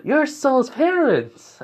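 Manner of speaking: high, drawn-out exclamation; excited realization. Acted. Mothers recognize Soul's parents, high excited exclamation. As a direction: gush it in amazed recognition.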